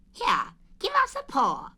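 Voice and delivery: high, lively Rabbit voice; high fussy rabbit; Rabbit's voice. Acted. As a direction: pitch Rabbit up, brisk and fussy. Rabbit voiced in read story.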